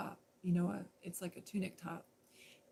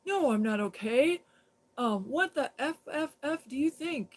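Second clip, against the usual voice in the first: louder, higher mock drunk snarl; drunk angry retort; 6 semitones higher, 7 dB louder than usual. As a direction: bark back like an angry drunk. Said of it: "And he goes".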